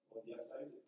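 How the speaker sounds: hushed yes. Pretend. Paul's hushed eager reply.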